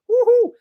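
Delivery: very high, loud "woohoo" cheer; excited whoop. Real, not acted. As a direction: let out a piercing whoop of delight. Cheering for the club's young players.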